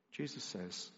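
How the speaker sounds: usual speaking voice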